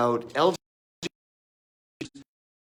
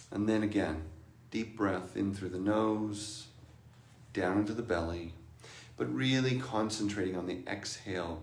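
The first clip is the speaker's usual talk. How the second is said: guided meditation; 4 semitones lower than usual. Acted. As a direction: ease them into stillness.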